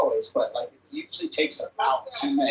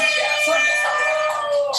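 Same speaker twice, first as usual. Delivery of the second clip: excited high shout; 4 dB louder than usual. Real, not acted. Commentator stresses the closing gap.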